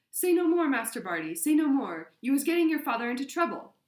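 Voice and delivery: high, loud elf wail; Winky pleading. A narrator's reading. Winky begs Master Barty to stop talking, frantic pleading.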